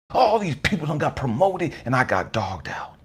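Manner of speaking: normal talking voice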